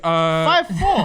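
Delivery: loud laughing jab; laughing teasing answer. Real, not acted. Mocking guess about height.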